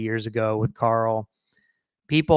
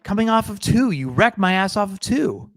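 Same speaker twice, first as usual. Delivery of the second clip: mock-angry accusation, pitched higher; raised, indignant role-play voice; playing an angry driver; 6 semitones higher, 3 dB louder than usual. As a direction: play-act confronting a rival driver. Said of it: "So I'm Kyle".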